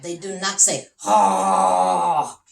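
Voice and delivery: loud wailing "Ha!"; mock cry. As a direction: belt out a crying "Ha!". Imitating a crying sound in story.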